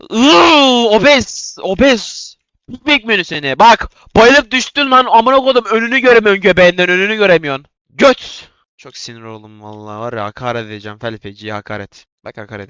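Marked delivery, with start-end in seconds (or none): 0.1-2.3 frustrated wail. Real, not acted. 2.7-8.5 high, loud mocking jeers; gloating insults. Real, not acted. Gamer jeering at the opponent he knocked down, loud mocking insults.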